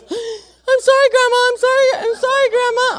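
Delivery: put-on very high, loud child's wail; hammed-up crying kid, squealing and pleading; mock tearful apology. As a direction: imitate a little boy bawling his apology. Voices terrified grandson's tearful apology.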